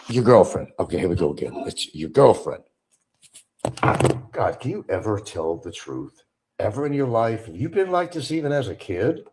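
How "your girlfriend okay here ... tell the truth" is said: ordinary talk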